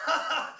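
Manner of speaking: loud, high burst of mock laughter; imitated doctor's laugh. Retelling a comic's doctor joke, imitated big laugh.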